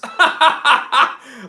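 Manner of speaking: high, loud staccato belly laugh; loud cackle. Real, not acted. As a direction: burst out laughing at your own joke. Laughs at own Canada joke.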